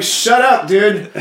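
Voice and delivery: high, loud exasperated hush; annoyed shush. Retold or imitated. Brothers silencing drunk Nemeth, exasperated. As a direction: snap it at the drunk. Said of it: "They're like".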